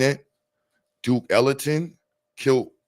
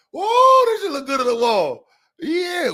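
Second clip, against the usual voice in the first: high, loud squealed whoa; mock exaggerated admiration; 6 dB louder, slower than usual. Streamer mocking a viewer's wall guitars with a high squeal.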